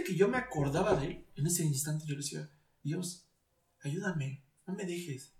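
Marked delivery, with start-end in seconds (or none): 2.8-5.3 softly retold prayer; quiet plea. Grieving man calling on God for help, quiet plea.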